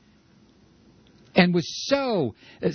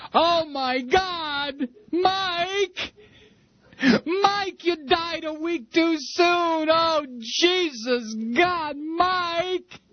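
high, sobbing wail of grief; wailing widow; 14 semitones higher, much slower than usual. Retold or imitated. Wife hearing the news, wailing and sobbing high. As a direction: cry out his name as if your heart just broke. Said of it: "she would hear this news and she would say".

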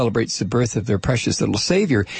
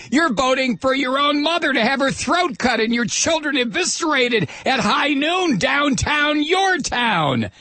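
hammed-up attack ad; 11 semitones higher than usual. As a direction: ham up a fearmongering political ad. Parodying attack ad narrator.

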